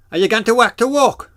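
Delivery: high, loud Geordie with "work" and "walk" blurred; broad Geordie question; Geordie accent. Shown as a demonstration. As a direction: Geordie workman asking.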